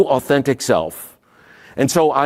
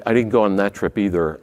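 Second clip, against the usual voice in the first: ordinary talk, nothing marked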